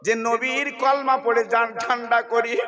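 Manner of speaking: loud, soaring sermon chant, high and ringing; full-force preaching. Acted. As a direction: preach it out to the whole crowd.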